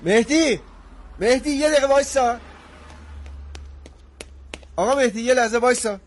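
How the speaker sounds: calling out. Acted. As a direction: shout his name so he stops. Calling after leaving friend.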